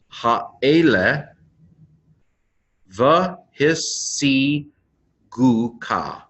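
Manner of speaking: one word at a time with long pauses; slow pronunciation reading. Shown as a demonstration. Teacher reading a Hebrew verse for learners, slowly word by word. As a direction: sound out each Hebrew word, stretching the syllables.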